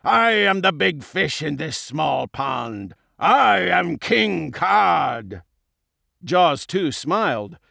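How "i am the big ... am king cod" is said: slow, grandiose villain voice; pompous bully announcing himself; boastful villain king. A narrator's reading. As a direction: proclaim it, building to a slow shout.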